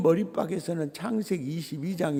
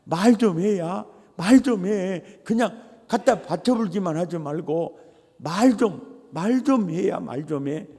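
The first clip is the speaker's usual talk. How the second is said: Jeolla dialect. Retold or imitated. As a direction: coax the listener in Jeolla dialect, '말 좀 해야'. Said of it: "이 말을 전라도 말로 하면".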